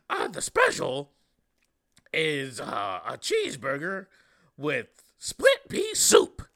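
cheerful monster waiter reciting the special; Grover voice. Retold or imitated. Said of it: "Grover would be like".

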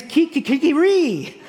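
high mock rooster crow kiki-kiki-ree; playful rooster cry; rooster call. Shown as a demonstration. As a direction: crow it like a foreign rooster, laughing. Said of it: "in many countries".

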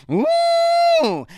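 high, sustained train whistle hoot; train horn imitation. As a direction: hoot like a cartoon steam train. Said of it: "train horn go like".

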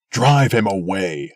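loud, furious cry; angry cry. A narrator's reading. Cruel old man shouting to the people, a loud angry cry. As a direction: drive the stranger off. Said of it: "cried the old man".

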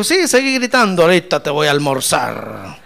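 lightly voiced hawk, loud; loud mock gloating from a predator. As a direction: taunt your prey loudly. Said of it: "el gavilán dijo".

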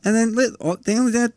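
gleeful high sing-song; excited. Real, not acted. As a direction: squeal the punchline with glee. Gleeful joke, high pitch.

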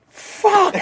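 high exasperated cry of fuck; a high, strained outburst of frustration; frustrated yell. Retold or imitated. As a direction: let it burst out in exasperation. Frustrated alone in the car.

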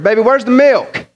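lightly hammed-up husband's call; mock husband calling out. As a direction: call across the house for help. Mimics husband at the fridge.

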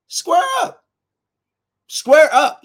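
loud, high call to fight; high-pitched confrontational shout; shouted challenge. Retold or imitated. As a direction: yell it like you're calling someone out.

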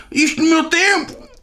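shrieking granny rant; old lady complaint. Retold or imitated. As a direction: whine it like a grumbling old woman.

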